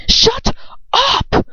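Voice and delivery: high, raw yell cracking into a cry; shout. Acted. Ron screaming at Lucius through tears. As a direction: scream it at him, near tears. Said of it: "Ron shouts".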